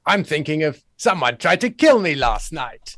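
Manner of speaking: put-on Cary Grant voice; Cary Grant impression. Podcast guest mimicking the film actor for the host, Cary Grant impression. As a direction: mimic the old film star's manner of speaking. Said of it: "that sounds just like her".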